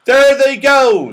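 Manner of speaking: loud, high, slow delighted cry. Acted. Moonface watching them tumble, delighted. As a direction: crow with glee, stretching it out. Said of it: "said Moonface in delight".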